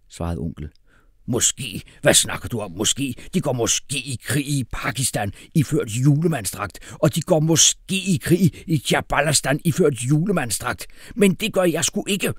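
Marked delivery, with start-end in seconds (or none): 1.3-12.3 angry tirade building to a high, loud refusal; screaming outrage. A narrator's reading. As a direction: start incredulous, climb into loud fury. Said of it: "skreg hr. Vibrant".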